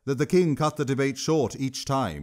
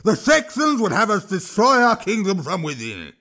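high, loud troll shout; a troll roaring over the clamour; high sing-song troll shout; 8 semitones higher, 5 dB louder than usual. Acted. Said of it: "Throch shouted".

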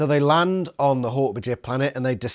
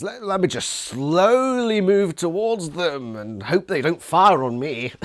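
slow, drawn-out mock pilot voice, pitched up; 4 semitones higher, slower than usual. Reviewer mimics the incompetent Andalite pilot's thinking, mockingly. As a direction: drag the words out to ridicule a hapless pilot.

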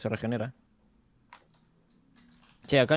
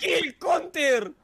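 much higher, slow drawn-out whine; whiny protest; much slower than usual. Real, not acted. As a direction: stretch out "mi kill" in complaint. Claiming his kill. (queja aguda y alargada)

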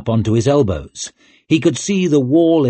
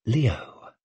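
hushed, barely-voiced name; whispered; 7 dB quieter than usual. A narrator's reading. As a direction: say it softly under your breath. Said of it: "she whispered".

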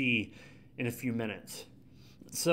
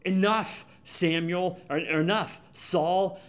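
frustrated; 4 semitones higher, slower than usual. Retold or imitated. Samuel cutting off Saul's excuses, exasperated. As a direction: cut him off, fed up and slow. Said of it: "Samuel gets so frustrated here".

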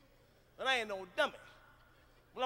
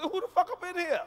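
higher, defiant comeback; 7 semitones higher, 4 dB louder than usual. Retold or imitated. Four-year-old kid talking back to mama, defiant. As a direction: sass it right back at mama.

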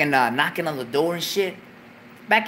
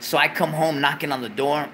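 ordinary delivery

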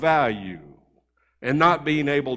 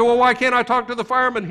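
worried, insistent; 8 semitones higher, 4 dB louder, faster than usual. Acted. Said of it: "really worried".

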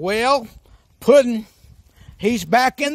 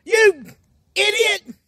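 scolding the script; 10 semitones higher than usual. Acted. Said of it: "that's what it says".

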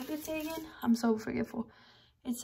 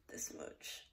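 unvoiced tipsy murmur; tipsy whisper; 18 dB quieter than usual. Real, not acted. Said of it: "And I'm a little tipsy".

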